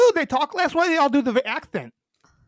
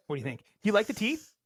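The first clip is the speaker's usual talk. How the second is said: ordinary talk, nothing marked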